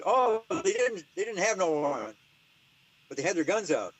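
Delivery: mock Irish man telling of the armed raid. The Irish man describing the armed cops, in a mock Irish accent. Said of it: "I'm gonna stop doing my Irish".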